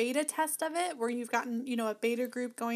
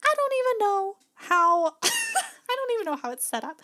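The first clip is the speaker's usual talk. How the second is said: laughing and pitched way up while admitting she's clueless; 9 semitones higher, 4 dB louder than usual. Real, not acted. Host laughing at her own mic setup, high giggly admission.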